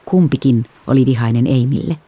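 ordinary delivery, nothing marked

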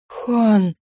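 a touch of strained effort on a lowish call; forced hoarse croak. A narrator's reading. Wife calling her husband, near tears, forced croak. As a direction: push the name out with effort. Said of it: "прохрипела через силу".

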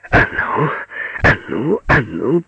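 building urging chant; growing ferocity. A narrator's reading. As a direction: egg yourself on, harder each time. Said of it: "всё более и более ожесточаясь". (всё ожесточённее, подгоняя)

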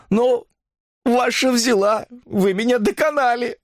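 high-pitched, weary confession; worn-down woman giving in; distressed sick woman. A narrator's reading.

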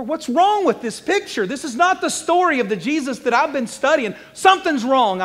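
high, shouted bafflement; loud protest. Acted. Preacher objecting to the Bible story, loud protest. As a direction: cry out that this is all wrong.

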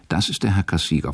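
ordinary talk, nothing marked

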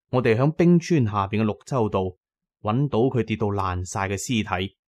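ordinary delivery, nothing marked

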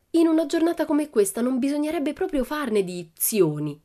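a hint of a bold little girl; Pippi. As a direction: Pippi a tratti sfacciata. Pippi's voice, cheeky.

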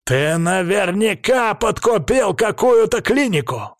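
high, outraged accusation; angry bark. A narrator's reading. Krestovsky disputes the test results, angry bark. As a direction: bark the charge at them. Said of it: "рявкает Крестовский".

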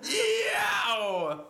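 high yelp of pain, laughing. Acted. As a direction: yelp like you got hurt. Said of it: "I'm gonna go".

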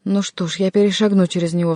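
ordinary delivery, nothing marked

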